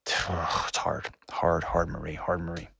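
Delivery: soft, hushed, sighing repeats of hard; sighing sympathy. Real, not acted. Host sighing with grief to caller Marie about her daughter. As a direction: breathe it out with real sorrow.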